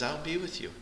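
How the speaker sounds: soft, high, reassuring "be" rising; tender promise. Acted. Preacher voices God comforting his people, soft and tender. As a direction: softly promise them you're there.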